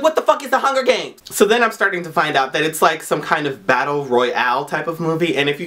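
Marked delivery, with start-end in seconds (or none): 0.0-1.2 exasperated disbelief; baffled outburst. Retold or imitated. Vlogger recalling hearing the hype everywhere, high baffled outburst. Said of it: "I was like".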